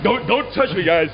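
high, frantic pleading; panicked pleading. Real, not acted. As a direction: beg them to stop, terrified. Being grabbed by guards.

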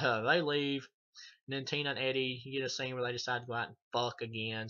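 ordinary talk, nothing marked

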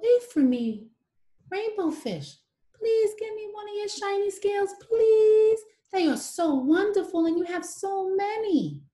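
high small voice, begging sweetly; pleading little fish. Acted. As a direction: beg for a shiny scale in a high voice.

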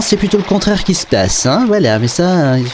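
usual speaking voice

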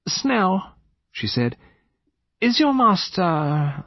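high, slow, drifting lady; extremely vague. A narrator's reading. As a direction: trail the question off unfinished. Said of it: "her manner was vague in the extreme".

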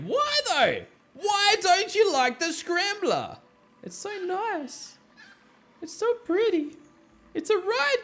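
high, slow whiny plea; mock pleading whine. Gamer pleading with villagers to enjoy the ride, whiny. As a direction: beg them to love the ride.

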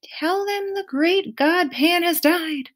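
high, slow cry from far off; mysterious voice. Acted. A voice from the shore to a passing sailor, slow and high. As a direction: call it out slowly like a distant voice. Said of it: "a mysterious voice crying out".

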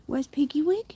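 high, loud call for the dog. Real, not acted. Owner calling her dog in the garden.

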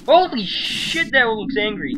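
startled high shout; alarmed shout. Real, not acted. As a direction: yell out in alarm at the snake. Snake appears, panicking.

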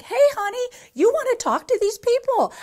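high, loud breezy husband; husband's voice. Retold or imitated. Husband calling his wife to the phone, high and loud. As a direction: chirp it up high like a cheerful husband.

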